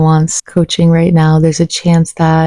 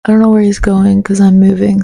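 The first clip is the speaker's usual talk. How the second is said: usual speaking voice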